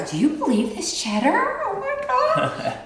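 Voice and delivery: soar up on God in wonder; thrilled disbelief, stretched out; amazed. Real, not acted. Amazed by the cheddar.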